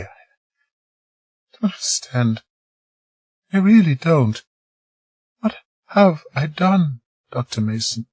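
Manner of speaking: a hint of Margaret, worried; lightly voiced woman.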